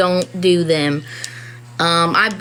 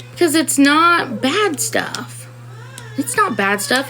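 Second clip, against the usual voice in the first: slow, choking up on "bad stuff"; tearful; 6 semitones higher than usual. Real, not acted. Mom defending her kids, voice breaking with tears. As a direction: voice breaking, close to crying. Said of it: "I'm trying not to cry".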